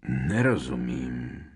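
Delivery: low, slow croak. A narrator's reading. Seer who cannot believe her ears, croaking. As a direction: croak it out, stretched and stunned. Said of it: "zachroptěla".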